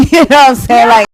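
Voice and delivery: loud fast laughing words. Real, not acted. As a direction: burst out laughing mid-question. Laughing at a dark joke.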